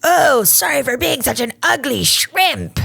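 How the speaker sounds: high, slow, squeaky gremlin voice; gremlin man voice. Pretend. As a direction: whine it like a little gremlin. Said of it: "gremlin man voice".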